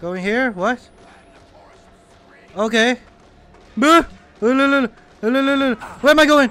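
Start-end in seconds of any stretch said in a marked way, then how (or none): 4.4-6.5 mock wail. Panicked in game.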